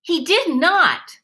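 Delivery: put-on boy snapping at his rival. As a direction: cut her off sharply.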